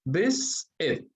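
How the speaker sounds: "Visit" lento, partido en dos sílabas; dividido en sílabas. Shown as a demonstration. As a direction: separa "Visit": vis, pausa, it. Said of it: "tiene dos sílabas". ("Visit" pronunciado despacio, sílaba por sílaba)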